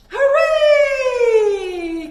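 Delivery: loud, high, drawn-out hooray; big cheer. Shown as a demonstration. Teacher leading kids in a loud cheering hooray. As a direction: cheer for the birds with everything. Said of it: "say hooray together".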